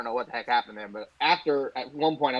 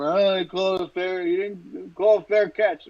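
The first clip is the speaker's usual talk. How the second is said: imitated drunk yelling; 6 semitones higher, slower than usual. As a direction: mimic a complaining fan in a raised pitch. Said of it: "The guy was yelling".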